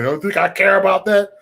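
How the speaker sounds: mocking quote. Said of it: "Oh, he said".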